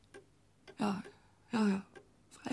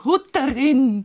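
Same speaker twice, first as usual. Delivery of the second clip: slow, louder appeal by name; urgent call; 3 semitones higher, 6 dB louder, slower than usual. Acted. Widow appealing to her neighbour, slow and louder.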